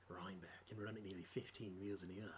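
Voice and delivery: low, soft Australian warm-up; Australian accent. Shown as a demonstration. Player getting into accent before D and D, quiet Australian. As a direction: murmur it low in broad Australian. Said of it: "then I would be into it".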